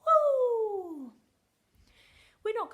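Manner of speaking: big high whoop of mock delight; loud, high, playful whoop; excited cheer. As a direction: cheer it out like a party. Cheering about spelling test.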